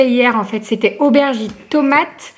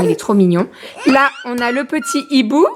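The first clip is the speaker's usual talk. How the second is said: ordinary talk, nothing marked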